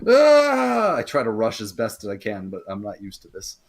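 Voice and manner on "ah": high, loud drawn-out "aah"; acted yell of exertion; effortful cry. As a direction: cry out loudly as you heave yourself up. Character struggles to get up and rush.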